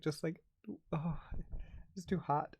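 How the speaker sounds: ordinary talk, nothing marked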